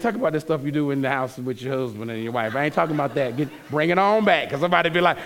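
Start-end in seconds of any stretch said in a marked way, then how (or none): none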